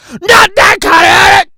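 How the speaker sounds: loud echo of the last line; shouted repeat. Shown as a demonstration. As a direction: echo it back as a loud yell.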